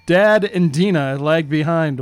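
ordinary talk, nothing marked

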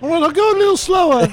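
very high, squeaky bunny puppet voice; high-pitched cartoon rabbit; Sid. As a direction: play a talking rabbit way up high. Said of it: "in Sid's voice".

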